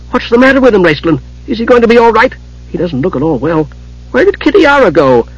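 high-pitched kender full of questions; anxious, inquisitive kender. A narrator's reading. Tass asking Raistlin about unconscious Palin, anxious and curious. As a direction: pester in a high, concerned voice.

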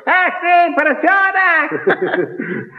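squeaky high yell, slow and laughing; high-pitched shout. Retold or imitated. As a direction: shriek it way up high, drawn out. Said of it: "кричал высоким голосом". (крик высоким голосом)

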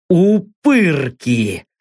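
slow angry hiss, drawn out. A narrator's reading. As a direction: spit the word out in a slow hiss. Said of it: "прошипел я". (медленно, со злым шипением)

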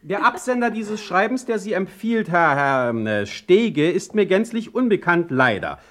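high, loud and disdainful; haughty rebuff. Acted. Heine brushing off the stranger's letter, haughty and high. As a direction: look down your nose at him from a height.

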